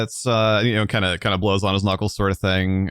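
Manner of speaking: normal talking voice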